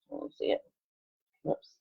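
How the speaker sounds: whispered to herself; hushed aside. Real, not acted. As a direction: breathe it under the reading. Aside while turning pages.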